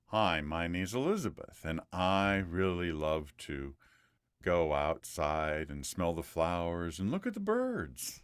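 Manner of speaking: low, soft, drawn-out delivery with melody; resonant example sentence. Shown as a demonstration. Coach models a resonant sentence for student, low and melodic. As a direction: speak slowly and low, melody rising late. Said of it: "can you say something like that".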